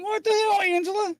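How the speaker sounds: high, startled "what the hell"; shocked outburst. Retold or imitated. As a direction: retell the jolt of disbelief. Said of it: "I was like".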